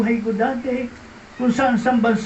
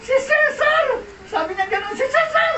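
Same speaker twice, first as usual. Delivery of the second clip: excited exclamation; 13 semitones higher than usual. Retold or imitated. Old friend crying out his name so Rodel recognizes him, very high. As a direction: burst out, very high.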